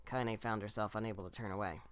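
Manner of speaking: ordinary delivery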